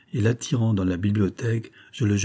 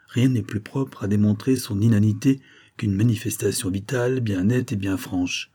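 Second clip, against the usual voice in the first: plain reading voice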